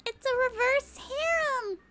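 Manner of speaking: high, loud squeal; gleeful squeal; excited exclamation. Real, not acted. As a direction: shout it out gleefully.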